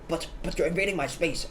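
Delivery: complaining stranger. Retold or imitated. Stranger objecting to his train neighbour, mildly mocked complaining. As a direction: imitate a man's repeated objection. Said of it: "this guy who's complaining".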